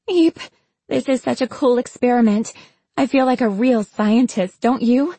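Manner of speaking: put-on high-pitched, excited young scientist; squeal, then gush in a high voice; giddy excitement. Cricket to her companion during the experiment, giddy and high.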